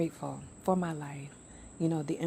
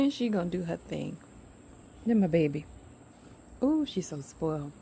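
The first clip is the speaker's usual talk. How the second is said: ordinary talk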